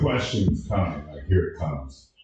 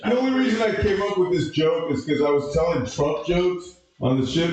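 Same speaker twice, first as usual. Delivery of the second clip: ordinary delivery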